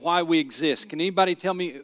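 ordinary talk, nothing marked

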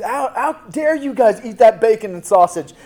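hammed-up indignation at the guys eating bacon; mock outrage.

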